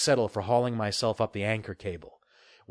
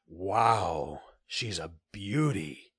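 softer and slow, in awe; gasped; 5 semitones lower, 5 dB quieter, much slower than usual. Acted. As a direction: breathe it out softly. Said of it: "he gasped".

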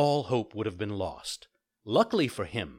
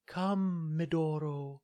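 high, gentle fairy voice; a kind fairy giving a calm order; 6 semitones higher, slower than usual. Acted. Fairy calls the poodle.

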